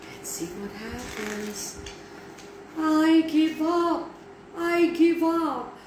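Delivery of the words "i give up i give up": high, slow miserable cry of defeat. A narrator's reading. Max surrendering to the flooding brooms, miserable. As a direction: give in miserably, drawing the words out high. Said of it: "said Max miserably".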